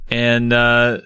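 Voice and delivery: ordinary delivery, nothing marked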